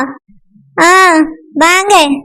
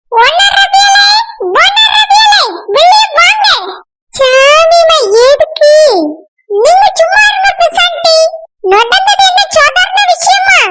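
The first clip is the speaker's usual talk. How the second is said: furious calling out; 7 semitones higher than usual. Acted. Woman shouting at the family to come outside, furious. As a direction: yell them out of the house in outrage.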